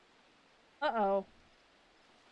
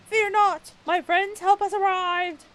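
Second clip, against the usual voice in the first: heroic shout; 8 semitones higher, 11 dB louder than usual. Acted. Heroic NPC arrives.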